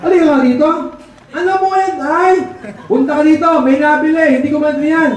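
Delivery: father yelling for his son to help; calling out to son. Acted. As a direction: call the kid over, exasperated. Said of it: "tinawag yung anak".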